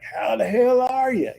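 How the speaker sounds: high, loud rowdy uncle greeting; loud obnoxious uncle. Retold or imitated. Describing the late uncle's loud obnoxious greeting. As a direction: bellow it like the family's loudest uncle. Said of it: "really loud, obnoxious".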